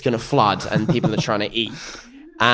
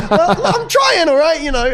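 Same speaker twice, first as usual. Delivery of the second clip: much higher, louder squeaky defensive protest; flustered defence; 5 dB louder than usual. Retold or imitated. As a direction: play your younger self squeaking back, stung. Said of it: "I was like".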